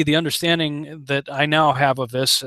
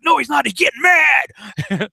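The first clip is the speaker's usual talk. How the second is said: mock shout; 9 semitones higher than usual. Cornerman insists Rocky is only getting mad, mock shout. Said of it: "He's like".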